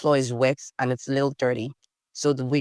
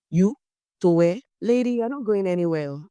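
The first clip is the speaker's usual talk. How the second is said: a scandalized mother, higher and louder; mock the mother's outraged disbelief; scolding mom; 5 semitones higher, 3 dB louder than usual. Mom scolding daughter in story.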